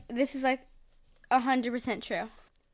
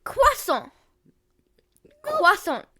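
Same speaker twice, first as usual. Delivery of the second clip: croissant said the French way, kwah-SOH, high; exaggerated French accent on croissant; 11 semitones higher than usual. Shown as a demonstration. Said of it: "What are you saying, croissant?".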